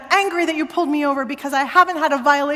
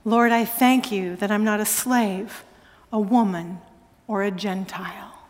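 lightly voiced pious prayer, lower; a hint of solemn thanksgiving; understated quoted prayer, lower; recited prayer; 6 semitones lower, 3 dB quieter than usual. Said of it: "they pray the prayer".

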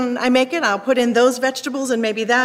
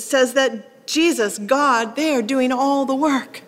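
ordinary talk, nothing marked

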